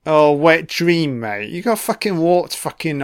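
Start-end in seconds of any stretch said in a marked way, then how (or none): none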